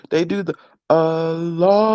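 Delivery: student imitating the adhan callers; imitating a long Allah. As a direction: stretch Allah the way some callers do. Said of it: "That's what they do. They hold it like that".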